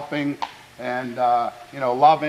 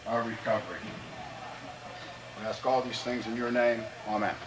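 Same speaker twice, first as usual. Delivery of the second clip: quiet, solemn prayer voice; bow heads and pray softly for the team; 3 semitones lower, 8 dB quieter than usual. Acted. Coach praying over his players before the game, quiet and solemn.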